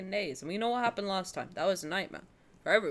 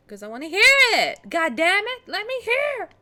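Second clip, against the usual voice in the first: loud, higher, exasperated outburst; frustrated shout; 8 semitones higher, 7 dB louder than usual. Real, not acted. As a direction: vent at the broken alerts. Can't hear alerts, frustrated.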